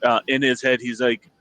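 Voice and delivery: ordinary delivery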